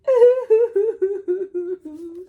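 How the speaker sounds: high, loud fake crying; hammed-up pretend sobbing; mock wail. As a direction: wail like a grumpy dinosaur.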